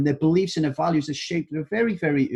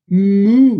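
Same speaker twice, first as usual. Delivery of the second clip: a loud playful moo, higher in pitch; mock cow moo; 4 semitones higher, 11 dB louder than usual. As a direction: moo like a little kid playing cow. Daughter imitates a cow.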